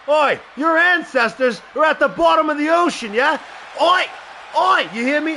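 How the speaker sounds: loud, high, jeering mock Cockney shout; mock Cockney accent. Wrestler mocking rival's Cockney accent, jeering shout. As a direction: bark Oi like a taunting Cockney lad. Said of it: "making fun of you and your stupid friend's accent".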